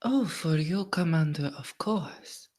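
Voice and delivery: gracious, lilting agreement; courtly lady Violette. Acted. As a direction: charm the commander politely. Player voicing flirtatious noblewoman to commander.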